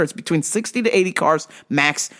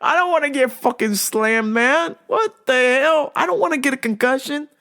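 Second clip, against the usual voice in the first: laughing; 8 semitones higher than usual. Real, not acted.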